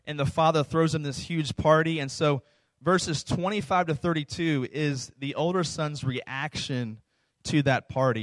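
normal talking voice

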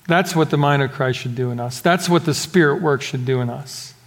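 ordinary talk

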